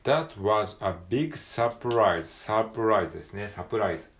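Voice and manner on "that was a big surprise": slow, deliberate, a bit louder; teacher reading aloud for the class; slow model reading. Shown as a demonstration. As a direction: lay out each word slowly. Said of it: "単語をピックアップしていきましょう".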